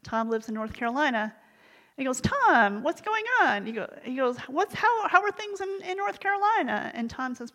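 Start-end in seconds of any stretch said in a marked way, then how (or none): 2.2-3.7 animated, high-pitched hello to a friend; phone greeting. Retold or imitated. Acting out a surprised phone greeting. 4.5-6.9 high, lilting catch-up question; acted upbeat phone chat; friendly phone small talk.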